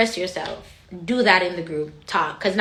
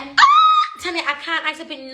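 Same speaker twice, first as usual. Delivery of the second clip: high delighted squeal then laughing words; excited shriek; 6 semitones higher, 5 dB louder than usual. Real, not acted. Excited shriek greeting Tanya with a laugh. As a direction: squeal with joy.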